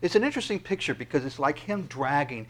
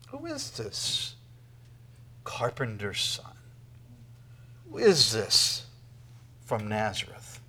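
scornful question; 4 semitones lower, 4 dB quieter, slower than usual. Retold or imitated. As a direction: sneer it like a doubting crowd. Mocking crowd doubting the Messiah.

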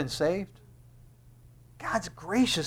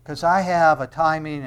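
ordinary delivery

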